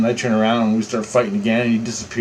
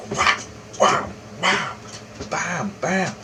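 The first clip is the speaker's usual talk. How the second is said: higher, soft punching noises; imitated punch sounds; 5 semitones higher, 6 dB quieter, much slower than usual. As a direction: mouth the blows like comic sound effects. Imitating punches landing in fight.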